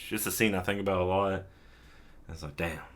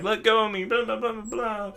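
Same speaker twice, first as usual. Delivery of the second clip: mock high protest; 13 semitones higher than usual. Chidori voiced pushing Katsuhiro away.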